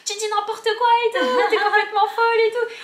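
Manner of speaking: high, loud, laughing as the kid mocks; laughing disbelief. Boy laughing at Chirine, high and loud. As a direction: laugh through the words at her. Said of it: "il commence à rigoler".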